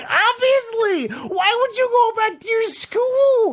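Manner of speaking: very high, stretched-out incredulous cry; high, drawn-out exasperated yell; exasperated outburst. Real, not acted. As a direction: wail the question in disbelief, slowly. Exclaims at teacher's stupid decision.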